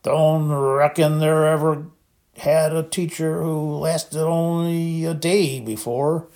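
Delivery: slow, slurred voice of a giant who has been drinking; a big drunk gamekeeper, slow and thick; thick, drunk Hagrid. A narrator's reading. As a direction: drag each word out like a man in his cups.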